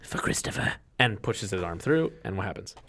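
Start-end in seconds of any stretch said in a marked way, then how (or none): 0.0-0.7 voiceless, breathy whisper; hushed in-character whisper after heavy breaths; whispered to himself. Acted. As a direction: whisper it privately, as a solemn vow. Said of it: "he whispers to himself".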